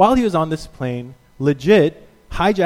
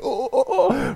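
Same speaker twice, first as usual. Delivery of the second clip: much higher mock gasps of awe; amazed crowd; 9 semitones higher, slower than usual. Onlookers watching a skinny janitor deadlift, amazed. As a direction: gym onlookers stunned.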